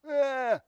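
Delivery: put-on high cry like a toddler who fell; brief imitated wail after a tumble; mock child's wail. Child falling, crying out.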